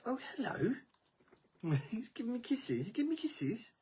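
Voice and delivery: high, unvoiced giggly whisper; delighted whisper. Real, not acted. Delighted whisper at pet kisses.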